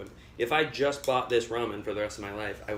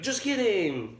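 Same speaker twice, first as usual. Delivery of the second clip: playful ghost voice; 10 semitones higher, 4 dB louder, much slower than usual. Retold or imitated. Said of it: "be like".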